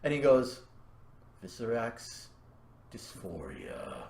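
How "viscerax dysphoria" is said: very soft, low, slow utterance; small voice. Acted. He says words of power to her, small quiet voice. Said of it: "a small voice in the beginning".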